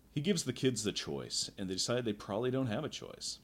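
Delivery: ordinary talk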